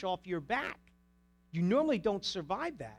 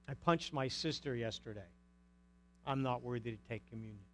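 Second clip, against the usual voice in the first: low, soft self-examination; mock guilty confession; 7 semitones lower, 6 dB quieter than usual. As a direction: murmur it like a sheepish confession. Acted self-examination before communion.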